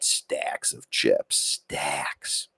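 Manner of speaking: whispered in awe; awed whisper. Real, not acted. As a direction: breathe the word, impressed by the chips. Awe at stacks of chips.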